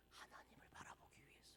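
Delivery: very soft, hushed whisper. Real, not acted. Preacher explaining why Israel was trapped, whispered. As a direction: let the voice vanish almost to breath.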